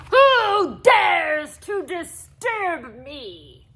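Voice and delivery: very slow, loud booming ogre; ogre's roar. A narrator's reading. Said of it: "roared a voice".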